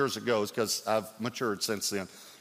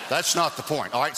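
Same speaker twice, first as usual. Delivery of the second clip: usual speaking voice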